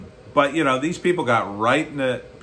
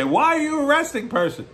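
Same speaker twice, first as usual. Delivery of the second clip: high, whiny mock protester voice; mock the arrested protester in a much higher voice; 13 semitones higher than usual. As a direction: squeak it out like an outraged protester. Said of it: "And when they say".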